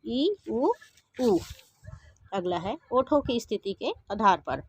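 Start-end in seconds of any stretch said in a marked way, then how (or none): 0.1-1.4 ई, उ, ऊ sounded out clearly and a little higher; teacher modelling closed vowels; vowel demo. Shown as a demonstration. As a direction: model each vowel ई, उ, ऊ distinctly. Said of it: "उदाहरण, ई".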